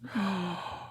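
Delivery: soft, high gasp of longing; hushed gasp of delight; craving gasp. Retold or imitated. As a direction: breathe in sharply at the thought of food. Gasping over the imagined dinner.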